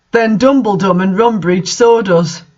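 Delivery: usual speaking voice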